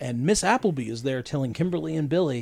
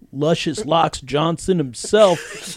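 slow, loud showman build-up; grand announcer intro; 5 semitones higher, 7 dB louder, slower than usual. Acted. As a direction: announce him like a hero. Hamming Tommy's grand entrance.

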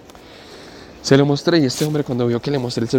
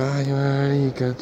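dismayed sigh; 3 semitones higher, much slower than usual. Retold or imitated. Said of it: "Y yo".

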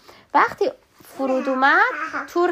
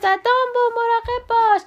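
urgent warning shout; 11 semitones higher than usual. Acted. Mouse yelling a warning to Dumbo on the unicycle, a high urgent shout. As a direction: shout to stop him crashing. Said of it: "تیموتی داد زد".

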